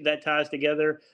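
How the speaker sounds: ordinary delivery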